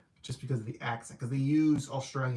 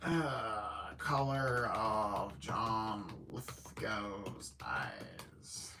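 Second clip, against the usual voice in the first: whispered to herself while searching; hushed private whisper; 4 semitones lower, 6 dB quieter, much slower than usual. Real, not acted. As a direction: breathe it under her breath, looking it up. Whispering while looking something up.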